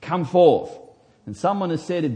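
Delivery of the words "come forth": loud, higher summons to the tomb; commanding call. Retold or imitated. Jesus calling Lazarus from the tomb, loud command. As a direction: call him out of the grave. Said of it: "he says".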